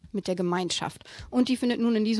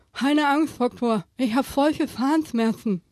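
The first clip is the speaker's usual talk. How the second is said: higher, louder hammed-up shark voice; shark babbling; 4 semitones higher, 5 dB louder, slower than usual. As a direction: blurt it out like a hurting shark. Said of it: "brappelte Hai".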